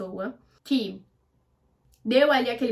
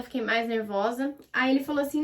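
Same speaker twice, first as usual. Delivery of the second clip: ordinary delivery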